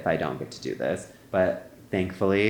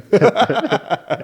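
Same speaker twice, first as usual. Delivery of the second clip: real laugh, much higher and louder; high burst of laughter; 12 semitones higher, 6 dB louder than usual. Real, not acted. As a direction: break into a high cackle of laughter. Laughing at her own story.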